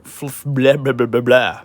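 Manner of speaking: tongue trips into blubbering nonsense; tongue-tied babble. Real, not acted. Reviewer fumbling a tongue twister for viewers, tongue-tied babble. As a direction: give up on the word and babble blah-blah. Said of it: "Say that three times fast".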